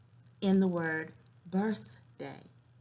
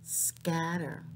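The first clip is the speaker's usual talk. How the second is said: usual speaking voice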